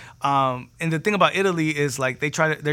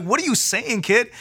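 urgent confusion; 7 semitones higher than usual. Retold or imitated. Baffled reply to panicking kid.

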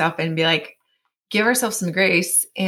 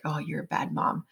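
hushed inner critic; 3 semitones lower, 10 dB quieter than usual. Retold or imitated. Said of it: "is like".

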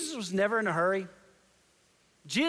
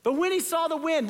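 raised emphatic reread; 9 semitones higher, 3 dB louder than usual. Shown as a demonstration.

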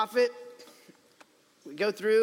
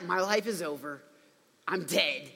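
lower, resigned voicing of despair; mock despair; 5 semitones lower, 4 dB quieter than usual. Jonah in a fish thinks he's dead, despair. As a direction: give up all hope, understated.

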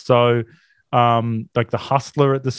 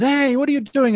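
mock cheerful Molly; 12 semitones higher than usual. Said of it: "Molly runs up and says".

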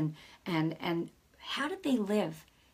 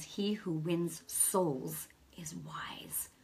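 whispered, slow and soft; hushed scripture quote; 2 semitones lower, 7 dB quieter, much slower than usual. Acted. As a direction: breathe the verse out like a secret. Quoting scripture softly.

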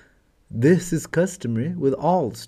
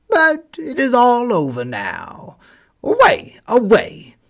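barking dog; 10 semitones higher, 5 dB louder than usual. Acted.

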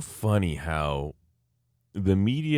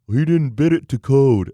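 louder, higher dim-witted contractor voice; mocking the contractor; 6 semitones higher, 9 dB louder than usual. Repeating the contractor's excuse in disbelief, loud mocking voice. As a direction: repeat his dumb line, hammed up. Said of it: "that's actually how he talks".

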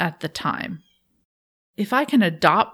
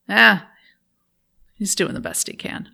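put-on Boston accent. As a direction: imitate dad's Boston accent, half-voiced. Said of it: "I'm trying to do his Boston accent".

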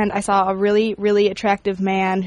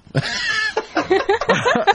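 high laugh; 13 semitones higher than usual. Real, not acted. Laughing at triumph.

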